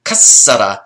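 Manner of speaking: Kassara with a long, forceful ss; intensive doubled S. Shown as a demonstration. Teacher showing an intensive verb, Kassara with lengthened s. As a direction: punch Kassara loudly, holding the s. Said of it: "So to intensively break".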